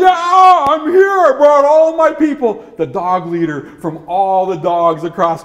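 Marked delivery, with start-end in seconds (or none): 0.0-2.6 very high, loud dog voice; high cartoon dog announcing its arrival; excited dog. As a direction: holler proudly as an eager dog. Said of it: "And the dog says".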